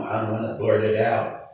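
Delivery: normal talking voice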